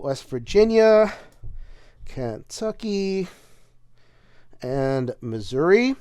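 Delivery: slow drawn-out Southern hint on Kentucky and Missouri; a barely restrained Southern drawl, slowly; held-back Southern accent. Shown as a demonstration. Reading state names for viewers, a held-back Southern accent. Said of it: "holding back the terrible Southern accent".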